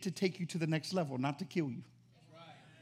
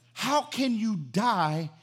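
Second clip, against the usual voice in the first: ordinary delivery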